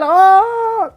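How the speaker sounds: a high, slow held "ah" like a yell he can't let out; stifled scream. Shown as a demonstration. Streamer showing viewers the yell he held in. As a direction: show the scream he had to hold back at night. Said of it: "Quería gritar".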